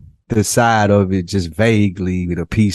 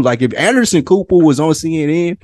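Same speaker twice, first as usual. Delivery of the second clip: excited; 7 semitones higher than usual. Real, not acted. Excited comic hypothetical.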